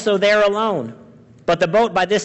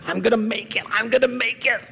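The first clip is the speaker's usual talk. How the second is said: high, strained struggle; mock gasping for air; 5 semitones higher, 3 dB quieter, faster than usual. Preacher imitating a drowning person refusing help, mock gasping. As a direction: act out straining to stay afloat, voice pushed up. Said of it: "don't just keep gasping for air".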